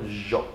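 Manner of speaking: YO said Argentine style with a zh; Argentine soft yo. Shown as a demonstration. Teacher likens J to Argentines, soft zh YO. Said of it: "Como la pronuncian los argentinos".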